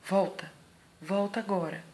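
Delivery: firm command to come back now; stern firm no. Shown as a demonstration. Mother scolding her toddler, stern and loud. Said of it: "levanto a sobrancelha e falo".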